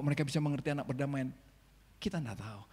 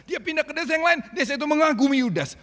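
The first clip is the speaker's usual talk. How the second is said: louder, higher preaching build; rising preaching; 12 semitones higher, 9 dB louder than usual. Acted. Preacher building up to the church. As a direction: push it up to the congregation.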